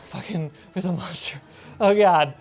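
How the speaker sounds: ordinary talk, nothing marked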